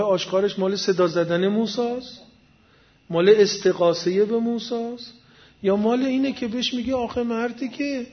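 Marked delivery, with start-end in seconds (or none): none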